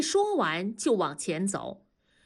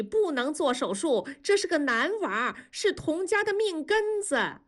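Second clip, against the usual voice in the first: higher-voiced old mother-in-law, digging in her heels; insistent mother-in-law; 8 semitones higher than usual. A narrator's reading. Old mother-in-law to the doctors, refusing the surgery, high and stubborn.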